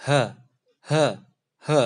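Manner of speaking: ha said low in the throat; deep guttural ha. Shown as a demonstration. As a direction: say ha with a thick, deep throat sound. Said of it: "seperti itu".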